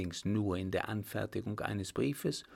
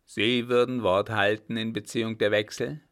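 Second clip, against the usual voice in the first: captain's voice, firmly louder; a louder grim captain demanding a straight answer; loud stern captain; 7 dB louder than usual. Acted. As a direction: press him hard, like a gruff officer. Captain grills the baron.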